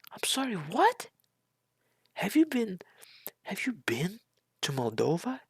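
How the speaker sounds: as if she misheard something absurd; stunned disbelief. Real, not acted. Vlogger reacting to the speech, squeaking in disbelief.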